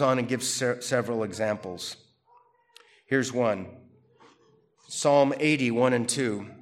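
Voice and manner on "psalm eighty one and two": usual speaking voice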